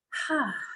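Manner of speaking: short, high falling sigh; settling sigh. Real, not acted. Yoga teacher settling onto the mat, sighing out. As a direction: let out an open sigh.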